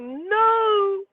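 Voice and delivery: high, drawn-out hammed-up wail; crying wail. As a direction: wail the words, breaking into stuttering sobs. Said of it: "I'm back there crying".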